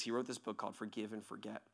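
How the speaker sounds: ordinary delivery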